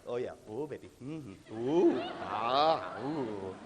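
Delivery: relaxed purring. Retold or imitated. Mimicking the group's easy second attempt, low and contented. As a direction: sink into it like it feels great. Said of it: "Second time, it's like".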